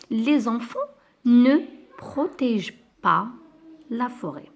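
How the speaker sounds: NE lancé très fort, séparé du reste; stress on ne. Shown as a demonstration. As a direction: appuyer fort sur le mot « ne », puis « protègent pas » détaché. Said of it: "La deuxième phrase, B". (la négation « ne » martelée, chaque mot séparé)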